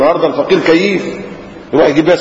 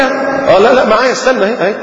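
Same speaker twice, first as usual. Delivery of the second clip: caught out; 8 semitones higher than usual. Retold or imitated. Retold man finding change.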